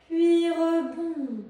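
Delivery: loud, slow rebound cue; loudly called, elongated exercise cue; drawn-out movement cue. Real, not acted. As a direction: stretch the words out along with the bounce.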